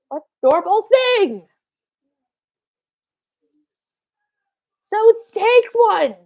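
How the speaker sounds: calling out. Real, not acted. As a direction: call back to someone out of sight. Calls to someone off mic.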